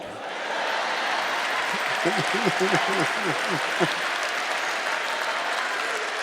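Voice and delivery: soft unvoiced laughter; breathy laugh. Real, not acted. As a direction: let out a quiet breathy laugh. Laughter after joke.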